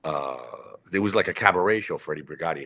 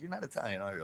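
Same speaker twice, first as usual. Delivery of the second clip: 1 teasing; 3 semitones higher, 11 dB quieter, faster than usual. Retold or imitated. Ed teasing question.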